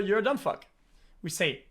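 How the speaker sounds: normal talking voice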